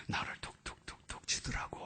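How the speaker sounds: unvoiced, hushed aside; whisper. Real, not acted. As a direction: whisper it softly. Whispered tender moment. (속삭이듯 조용히)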